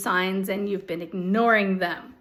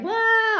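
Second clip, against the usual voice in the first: mock wail; 11 semitones higher than usual. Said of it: "you're just like".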